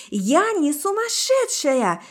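high, drawn-out indignant cry; high-pitched hysterical denial; shrieked protest. A narrator's reading. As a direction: squeal it in outrage, stretching the words. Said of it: "взвизгнула я".

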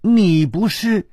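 stammering, dumbfounded questions; dazed. A narrator's reading. Hero learns of a mix-up about the bride, dazed. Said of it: "他痴痴地说".

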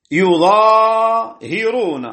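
"يظاهرون" as yuZaahiruna, slow and high, long aa; damma on ya. Shown as a demonstration. Teacher showing Asim's reading, "يظاهرون" slowly as yuZaahiruna. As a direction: start "يظاهرون" on yu, single Za, hi after the alif. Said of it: "على وزن يقاتلون بضم الياء".

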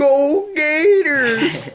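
very high, slow drawling Southern cheer; Southern fan cheer. Pretend. Cheering on the Gators in a hammed-up Southern fan voice. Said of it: "more like South, South accent".

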